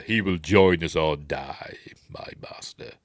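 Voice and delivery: slow, drawn-out villain imitation; slow mock-menacing line, fading away at the end; mock Vader. Vader answering his master the Emperor, slow mock villain.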